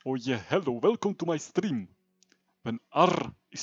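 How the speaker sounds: put-on light Slavic accent, hammy. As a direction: greet viewers in a faint Slav accent. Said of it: "Slav accent, right?".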